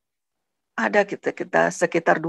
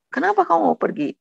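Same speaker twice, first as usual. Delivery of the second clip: high-pitched puzzled question; mock-startled husband asking why; surprised retold question; 12 semitones higher than usual. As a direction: imitate his startled question, pitched well up.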